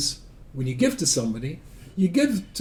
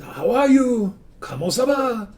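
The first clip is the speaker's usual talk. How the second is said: put-on high, lilting French-accented greeting; 9 semitones higher, slower than usual. Old adversary suddenly phoning up friendly, imitated in French accent. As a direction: mimic a Frenchman's high-pitched phone greeting. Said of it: "he had a French accent".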